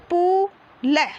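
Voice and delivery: "पु ल" split apart, पु stretched long; syllable by syllable. Shown as a demonstration. Teacher shows pupils how to read, syllable by syllable. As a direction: sound out पु, pause, then ल. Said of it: "ऐसे पढ़ना आपको".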